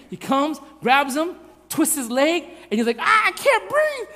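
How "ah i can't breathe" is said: put-on high, slow yell of a pinned child; imitating a kid's strained, panicked shriek; mock panicked cry. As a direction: cry out as if being crushed, hammed-up. Imitates pinned son freaking out.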